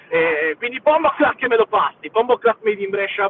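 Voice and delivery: high, loud hype shout-out; excited shout. Real, not acted. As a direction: hype up the podcast name.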